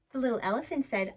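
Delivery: plain reading voice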